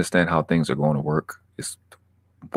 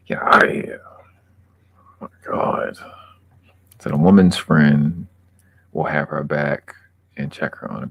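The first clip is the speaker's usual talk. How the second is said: ordinary talk, nothing marked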